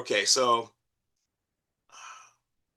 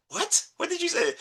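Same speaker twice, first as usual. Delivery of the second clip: much higher, squeaky confused asking; mock bewildered what, pitched way up; baffled question. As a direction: play someone who just didn't catch it. Characters baffled by the accent.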